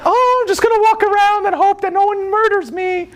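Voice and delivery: very high, breezy mocking voice; mocking someone with no expectations; mock carefree airhead. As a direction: play a clueless carefree person. Mocking careless attitude.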